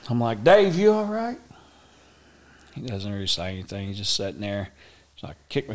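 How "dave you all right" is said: high, loud call to a friend. Retold or imitated. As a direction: call his name across the road. Calling out to friend.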